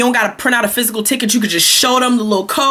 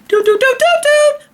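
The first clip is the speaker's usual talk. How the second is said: siren noise. Pretend. Mimics health inspector siren.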